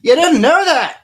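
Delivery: high, loud mock shock; mock disbelief. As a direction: fake astonished question. Mock disbelief, then "just kidding.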